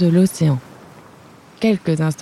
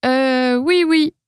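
high, hesitant mumble; stammering; 6 semitones higher, slower than usual. Acted. Little dragon stammers to his angry king, high and unsure. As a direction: stammer it out nervously. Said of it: "bredouille Baluchon".

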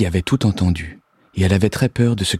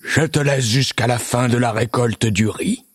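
raised-pitch monster ultimatum; lightly voiced commanding monster; monster crying out; 4 semitones higher than usual. A narrator's reading. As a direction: issue the deadline as a monster. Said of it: "Il s'écria".

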